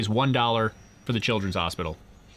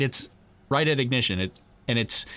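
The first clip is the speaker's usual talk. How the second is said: ordinary talk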